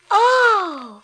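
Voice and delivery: high, loud gasp of wonder; delighted gasp. A narrator's reading. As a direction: gasp in amazement. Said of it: "cried Cinderella".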